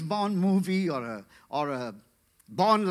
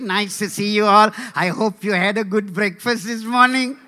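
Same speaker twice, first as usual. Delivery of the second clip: put-on chatty Indian accent, far too polite; nice Indian villain. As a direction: greet everyone sweetly in an Indian accent. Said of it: "You put an Indian man up there".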